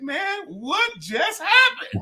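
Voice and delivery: slow, high-pitched bafflement; mock perplexed. Comic imitating his friend at the bar, perplexed and high. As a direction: stretch it out in high disbelief. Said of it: "He was so perplexed".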